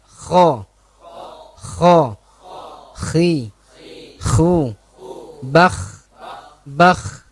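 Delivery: "Kho" with a rasping throat kh and audible breath; "Bakh" ending in a breathy throat friction; kho demo. Shown as a demonstration. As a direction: scrape the kh from the throat, let the air out.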